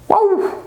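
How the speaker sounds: high-pitched little woof; dog bark. Pretend. Teacher showing what dogs do, dog bark. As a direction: yap like a dog.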